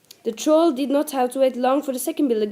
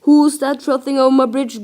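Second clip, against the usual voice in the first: a touch louder, challenging troll growl; 2 semitones higher, 7 dB louder than usual. A narrator's reading. Troll challenges the smallest goat on his bridge, growling. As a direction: demand it loudly, like a guarding troll. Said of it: "growled the troll".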